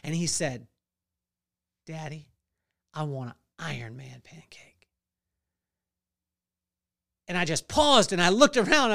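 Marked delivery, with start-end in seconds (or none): 1.9-4.9 put-on hushed little kid's request; soft whispered plea from a small boy; child's whisper. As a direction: whisper it like a shy child asking. Son asks for pancake.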